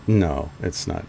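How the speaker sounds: ordinary talk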